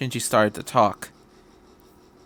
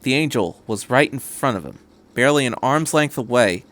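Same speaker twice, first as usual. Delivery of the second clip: usual speaking voice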